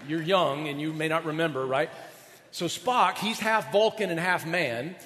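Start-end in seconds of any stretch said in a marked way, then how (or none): none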